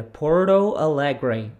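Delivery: put-on slow "Porto Alegre" as "podo alegre" with an American accent; American reading. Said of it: "ele vai ler dessa maneira". ("Porto Alegre" com sotaque americano)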